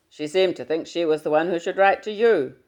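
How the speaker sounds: booming fatherly hello down the line. Acted.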